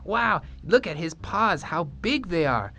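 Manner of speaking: put-on very high, excited children's chatter; children gawking at a lion; awed kids. A narrator's reading. Students admiring the lion in high, excited voices.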